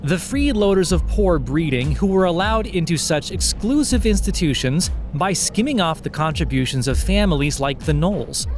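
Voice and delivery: ordinary delivery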